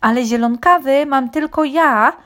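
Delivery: high, loud rooster cry; exclaiming rooster. A narrator's reading. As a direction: crow it out proudly. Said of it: "wykrzyknął kogut".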